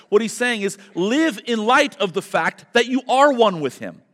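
fervent, loud, high preaching. Acted. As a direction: preach it out to the congregation. Preaching at full force.

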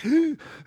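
sharp, high mimicked gasp; imitated choking gasp. Father imitating his daughter struggling to breathe, imitated gasp. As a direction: suck in a loud, high gasp like a choking child. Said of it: "Finley's like ... breathing weird".

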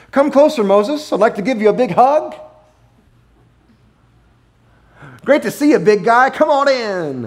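high, sugary welcoming voice; high mock-friendly greeting; Mister Rogers' God. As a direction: gush like a sweet TV host. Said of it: "Mister Rogers' God does".

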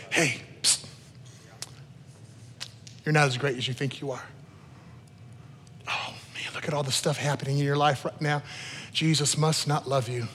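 the enemy whispering lies in your ear; insidious whisper. Acted. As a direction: murmur it low, like a secret taunt. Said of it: "sounds like a whisper in your ear".

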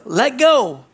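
high, loud command; voice from heaven. Acted. Heaven instructing the man on the branch, called-down command. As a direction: call it down from above.

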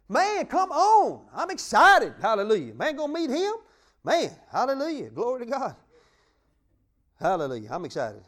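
loud, high joyful preacher's cry; full-force preaching. Acted. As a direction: whip the congregation into joy.

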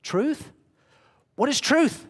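scoffing, sarcastic Pilate; sarcastic mockery. Shown as a demonstration. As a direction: ridicule the very idea of truth. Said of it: "Is it this?".

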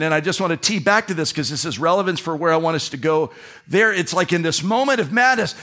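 usual speaking voice

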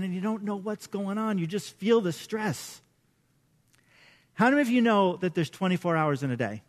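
ordinary talk, nothing marked

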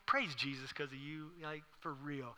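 softly sarcastic pious thanks; understated, pretend-touched praise; mock gratitude. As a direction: quietly feign being blessed by the insult. Mock gratitude during a heated fight.

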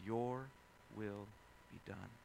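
hushed resigned prayer; quiet surrender. Retold or imitated. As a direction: let go and yield, softly. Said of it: "But then".

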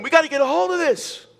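high, insistent mock voice of the legalists; mock Judaizers. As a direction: play the rigid traditionalists pushing their rules. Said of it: "these people are saying".

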